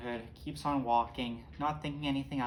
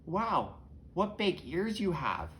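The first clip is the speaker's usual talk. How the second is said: high, slow wondering voice; marvelling little girl, pitched up; 7 semitones higher, slower than usual. Acted. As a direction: draw it out with swooping amazement.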